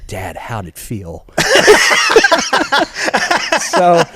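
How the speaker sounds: laughing. Retold or imitated. Quoting son, laughing.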